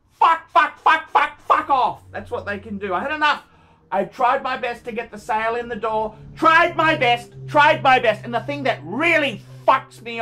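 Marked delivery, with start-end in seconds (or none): 0.1-2.0 loud, high volley of curses; angry outburst. Real, not acted. Seller fed up with Gumtree, angry outburst. 6.4-8.2 loud, raised protest; exasperated insistence. Real, not acted. Seller who tried to get the sale, exasperated insistence.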